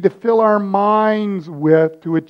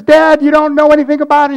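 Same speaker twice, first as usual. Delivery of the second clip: put-on high, loud, rushed kid's protest; hammed-up protesting child; 8 semitones higher, 5 dB louder, faster than usual. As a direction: protest fast and high like an upset kid. Acted child protesting to father.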